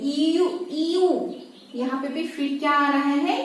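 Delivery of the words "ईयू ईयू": ईयू drawn out slowly as a long "ee-yoo"; slow, isolated "ee-yoo" for ईयू; sound demonstration. Shown as a demonstration. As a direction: stretch ईयू into a slow glide from "ee" to "oo". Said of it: "ये sound आ रहा है बार बार".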